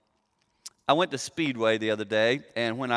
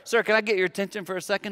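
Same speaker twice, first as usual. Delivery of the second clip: raised, fast call across the store; retold yell; 6 semitones higher, faster than usual. Preacher retelling calling to the store manager, raised and quick. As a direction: call out to get his attention. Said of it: "and I just yelled".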